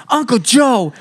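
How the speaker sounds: high reproachful name call; a hint of mom warning; scolding mom. Mom warning uncle, high reproach. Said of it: "my mom would be like".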